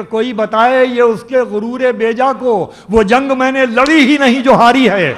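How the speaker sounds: loud stage declamation of a couplet; ghazal recitation. Acted. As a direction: ring out the verse to the hall. Poet recites verse at mushaira.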